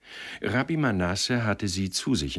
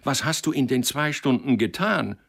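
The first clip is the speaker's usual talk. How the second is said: agitated demand; 7 semitones higher, 4 dB louder than usual. A narrator's reading. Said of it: "Der erregte Mann".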